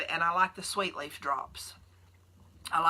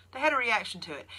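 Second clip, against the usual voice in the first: ordinary talk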